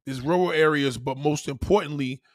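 ordinary delivery

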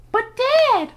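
put-on high girl's voice. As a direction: voice young Margaret up high. Margaret voiced in story.

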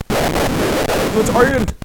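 slow, high, hammed-up Irish accent; bad Irish accent. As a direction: drag it out in a mock Irish accent. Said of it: "That's a pretty shitty Irish accent".

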